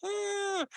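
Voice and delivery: tiny high gleeful cackle; squeaky creature cackle. Acted. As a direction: cackle like a swarm of cheering mites. Dust mites cheering around monster.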